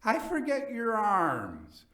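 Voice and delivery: tipsy Fortunato. A narrator's reading.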